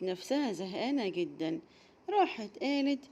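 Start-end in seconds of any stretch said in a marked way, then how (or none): none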